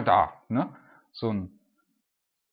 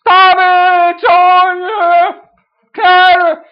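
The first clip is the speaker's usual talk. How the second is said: very high, slow desperate scream; screaming louder; 17 dB louder, much slower than usual. Acted. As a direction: scream it even louder than before, pleading. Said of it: "er aber schrie noch viel mehr".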